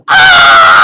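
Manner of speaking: sudden shrill panicked scream; startled wife shrieking; wife's scream. Retold or imitated. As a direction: scream in shock at a bloody hand. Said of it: "was to go".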